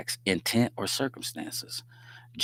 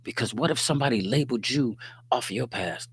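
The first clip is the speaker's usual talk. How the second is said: ordinary talk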